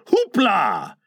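light, merry "Hoopla" from one voice. A narrator's reading. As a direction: cry it gaily without shouting.

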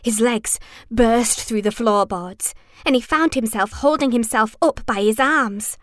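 ordinary delivery

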